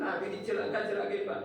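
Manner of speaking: ordinary delivery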